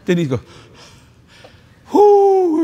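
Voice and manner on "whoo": long, high, loud "whoo"; awed whoop. Retold or imitated. Preacher imitating a woman wowed by a valuable piece, high whoop. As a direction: whoop high and drawn out in mock awe. Retelling Denise's awed reaction.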